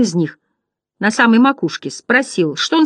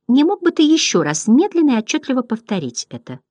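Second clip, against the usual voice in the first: higher, exaggeratedly courteous request; pointedly polite; 6 semitones higher than usual. A narrator's reading. First butterfly asking Bastian, pointedly polite voice. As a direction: ask with overdone politeness. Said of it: "подчёркнуто вежливо".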